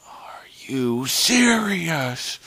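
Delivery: high, very drawn-out, strained disbelief; mock yelling. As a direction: stretch it out in exasperated incredulity. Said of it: "I was yelling at this point".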